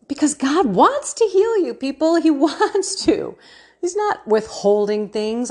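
lifting them with a joyful push, voice way up; excited. Real, not acted. Host urging her listeners, high and laughing.

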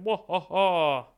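slow mock villain laugh; slow hammed-up evil laugh; evil cackle. Villain laugh for Bill Gates.

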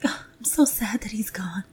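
hushed whispered mock grief; restrained whisper mourning. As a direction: whisper a polite sniffly sorrow.

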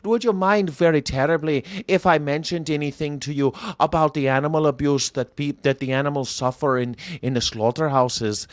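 joking, polite Irish lilt; mock-Irish politeness; Irish accent. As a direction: put on a very courteous Irish accent. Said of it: "I can't talk like that".

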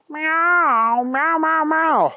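high mewing kitten voice; cat meow. Acted. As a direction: mew it like a small cat. Cat looks around hideout.

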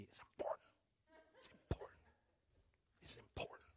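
"It's important" as a soft whisper; like Gigi. Pretend. Pastor copies Gigi for the church: "It's important" whispered. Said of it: "Gotta say it like Gigi".

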